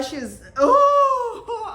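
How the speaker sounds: loud, high laughing outburst; laughing disgust. Real, not acted. Vlogger recalling vomiting on TV: laughing disgust.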